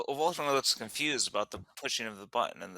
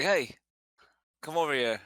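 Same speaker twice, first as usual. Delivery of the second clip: calling out; 4 semitones higher, 4 dB louder than usual. Acted. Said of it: "bang on the gate and be like".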